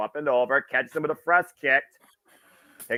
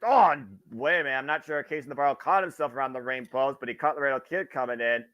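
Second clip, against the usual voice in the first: wrestling commentator; 3 dB quieter than usual. Acted. Play-by-play wrestling call.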